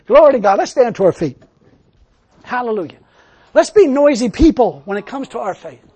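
loud, exhorting preacher's call; rally the congregation to get noisy; yelling praise. Real, not acted. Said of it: "I'm just yelling more".

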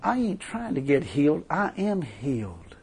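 low, quiet defiance slowing on the last words; acted calm, settled certainty; firm rebuttal. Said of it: "He said, "Mister Devil".

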